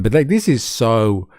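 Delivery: ordinary talk, nothing marked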